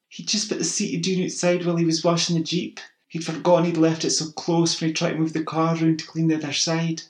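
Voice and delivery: high, loud anxious explaining; pleading neighbour. A narrator's reading. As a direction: rush to defend him.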